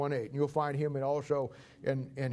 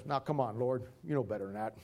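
calming Moses; 5 semitones lower, 4 dB quieter than usual. Acted. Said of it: "Moses says".

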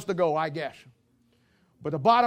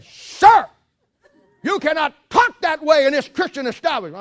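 high, loud shocked scolding; indignant store manager; 7 semitones higher, 8 dB louder than usual. Retold or imitated. Store manager rebukes a customer's language, loud and indignant. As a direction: scold him like an outraged shopkeeper.

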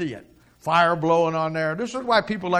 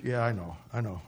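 lower, softer brush-off; ashamed Peter waving off his friend, quiet; glum Peter; 5 semitones lower, 7 dB quieter than usual. Acted. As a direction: mumble it away. Peter dejected.

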